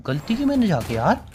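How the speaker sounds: usual speaking voice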